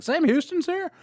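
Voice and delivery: astonished to hear the legend is there; surprised. Retold or imitated.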